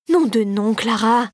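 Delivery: ordinary delivery, nothing marked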